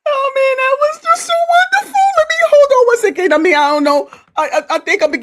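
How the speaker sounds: high, breaking voice fighting back tears; real tears, voice cracking high; overcome with emotion. Real, not acted. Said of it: "I think I'm getting emotional".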